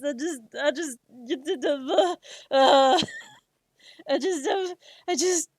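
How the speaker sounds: tearful broken-off stammer; laughing through tears. Retold or imitated. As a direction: break down mid-sentence, half laughing half crying. Breaking down crying while retelling.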